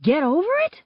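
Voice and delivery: high, rising question in disbelief; incredulous echo. A narrator's reading. Girl throwing friend's callous words back, incredulous. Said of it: "I repeat".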